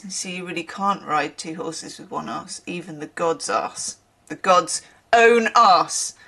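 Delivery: normal talking voice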